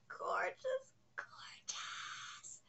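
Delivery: breathless, whispered gorgeous, fading to nothing; awed whisper. Real, not acted. Awed by the anatomical art, whispered praise. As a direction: whisper it like you can't breathe.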